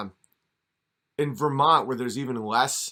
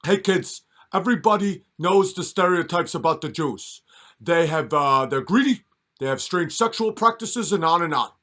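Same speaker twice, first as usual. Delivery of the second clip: put-on higher and louder, lecturing the kids like Bernie; Bernie Sanders impression; 4 semitones higher, 6 dB louder than usual. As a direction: preach to the kids in a raised, lecturing old politician voice. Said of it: "sounding like how Bernie Sanders was talking".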